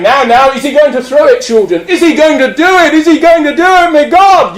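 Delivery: high, rising showman suspense; hammed-up alarmed announcer; bottler working crowd. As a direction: whip up the children in a high voice. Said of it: "the bottler's going".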